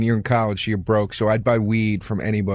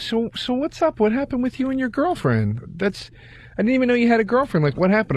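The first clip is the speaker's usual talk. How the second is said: lightly higher-pitched imitation of a friend; retold female friend; 11 semitones higher than usual. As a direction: voice her question higher, lightly acted. Retold friend gently probing about breakup.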